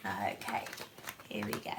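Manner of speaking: usual speaking voice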